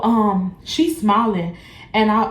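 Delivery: ordinary delivery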